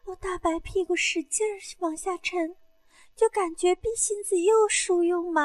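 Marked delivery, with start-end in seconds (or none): none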